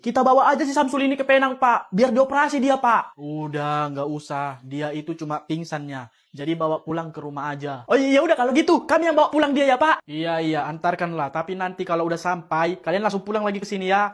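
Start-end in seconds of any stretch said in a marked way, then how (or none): 3.1-7.9 lightly voiced teacher. Teacher voice, low and soft.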